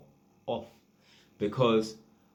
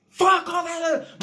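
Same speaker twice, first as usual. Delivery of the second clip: sudden high yelled outburst; sudden shout; 7 dB louder than usual. Retold or imitated. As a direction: blurt it out as a loud tic. Tenant shouts at the door.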